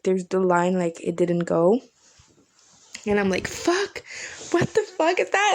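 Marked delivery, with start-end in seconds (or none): 3.5-5.5 mock exasperation. Quoting frustration at cancelled Uber.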